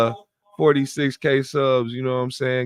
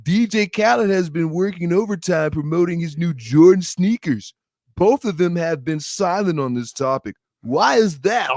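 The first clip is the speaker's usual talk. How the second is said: slow nasal mocking interviewer voice; nasal voice with snot in the throat; DJ Vlad voice; 4 semitones higher, 4 dB louder than usual. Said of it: "I gotta have a nasally voice".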